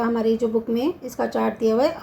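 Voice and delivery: ordinary delivery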